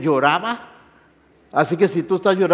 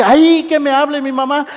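high, loud mock pleading; pleading wish; 10 semitones higher, 8 dB louder than usual. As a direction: wail it hopefully, rising higher and louder. Y dije.

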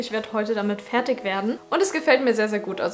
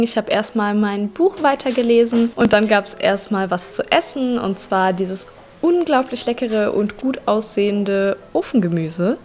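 louder, even vlog voiceover; voiceover narration; 6 dB louder than usual. Acted. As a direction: narrate the day's recap clearly. Recorded voiceover recap. (Voiceover-Erzählung)

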